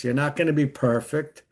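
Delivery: ordinary delivery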